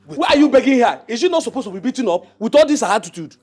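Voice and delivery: high, loud defiant ranting; angry rant. Acted. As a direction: shout him down. Ranting at his friend and queen.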